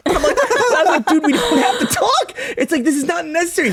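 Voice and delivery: high laughing disbelief. Real, not acted. Laughing retelling of awkward chat.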